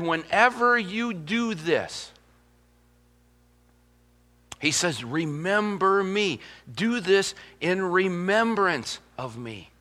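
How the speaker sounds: slow, soft words of Jesus; solemn, slow quote. Acted. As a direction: say it slowly, like Jesus at supper.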